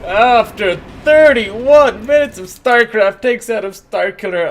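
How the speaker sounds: lively esports caster commentary; play-by-play casting. Acted. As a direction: call the game like a caster. Casts the game live.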